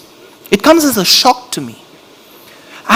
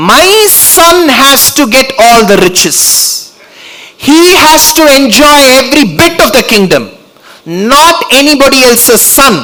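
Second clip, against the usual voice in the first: greedy queen; 7 semitones higher than usual. Retold or imitated. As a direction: insist on your son's claim. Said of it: "she said".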